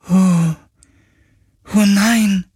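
ghost voice. Acted. Ghost grieves.

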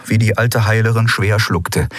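usual speaking voice

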